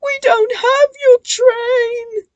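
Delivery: high, loud pleading cry; frightened cry. A narrator's reading. Twins fleeing the ghost, crying. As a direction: plead in panic. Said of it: "the twins cried".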